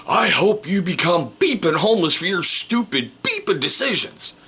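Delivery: mock a furious shopper yelling across the store; loud angry rant. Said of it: "I hear this very loud voice go".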